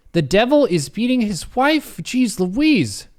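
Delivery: high, incredulous read-out; appalled disbelief. Real, not acted. Reading a shocking name for a sun shower, incredulous. Appalled at absurd quiz options.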